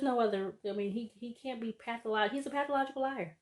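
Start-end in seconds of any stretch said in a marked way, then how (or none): none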